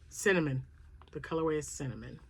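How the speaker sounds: ordinary delivery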